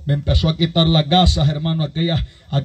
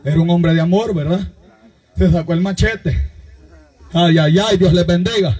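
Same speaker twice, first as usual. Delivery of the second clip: raised, driving pulpit voice, pushing each phrase; fiery preaching; 5 semitones higher than usual. Acted. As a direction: preach it to the whole congregation. Preacher retelling Saul story.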